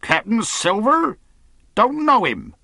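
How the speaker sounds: high, scornful shouted question; loud cry. A narrator's reading. Captain refuses to know Captain Silver, loud cry. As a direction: call it back as if disbelieving. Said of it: "cried the captain".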